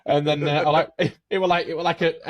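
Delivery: usual speaking voice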